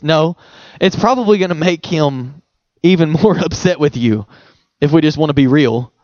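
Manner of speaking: ordinary delivery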